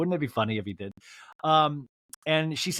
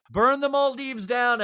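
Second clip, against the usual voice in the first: mock decree; 10 semitones higher, 3 dB louder, slower than usual. Real, not acted. Mock proclamation to everybody.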